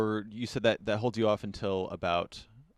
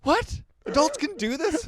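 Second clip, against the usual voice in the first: put-on much higher astonished child voice; amazed kid; 5 dB louder, slower than usual. Daughter amazed at her dad's prank, high-pitched. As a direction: gasp it like a stunned little girl. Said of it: "my daughter was like".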